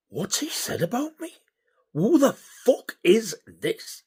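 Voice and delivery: high-pitched, incredulous inner voice; baffled disbelief. Retold or imitated. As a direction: think it in high, bewildered disbelief. Baffled private reaction to caller.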